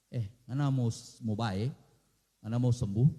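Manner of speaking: low, plain Manado question; lightly voiced Jesus asking in dialect; gentle Jesus. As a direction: ask him casually. Jesus asks the sick man.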